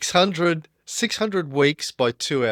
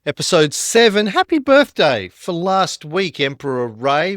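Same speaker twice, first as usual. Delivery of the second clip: loud, bright show opener; podcast host intro; 5 dB louder, slower than usual. Acted. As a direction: welcome the listeners like a host.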